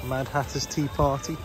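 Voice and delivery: normal talking voice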